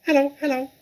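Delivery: high squawky parrot imitating a human. Parrot greeting the children with a high squawk. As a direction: chirp it out like a talking bird. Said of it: "the bird called down to her".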